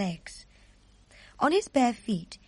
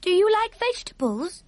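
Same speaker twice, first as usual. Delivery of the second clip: put-on high, small girl voice asking; a young girl's puzzled question; curious little girl; 10 semitones higher, 4 dB louder than usual. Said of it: "Sophie asked".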